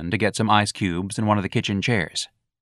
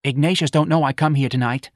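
higher, louder fussy matron; chatty old mother; 7 semitones higher, 3 dB louder than usual. Acted. Missus Riley telling Santa about the curtains, aghast. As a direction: chatter and complain about your son.

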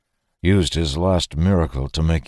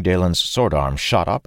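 plain reading voice

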